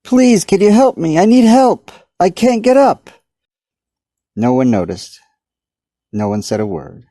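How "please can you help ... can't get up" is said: high, loud desperate pleas; yelling. A narrator's reading. Stuck man yelling at passing guard, desperate. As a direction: shout at the guard, begging. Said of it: "A guard walked by and he yelled".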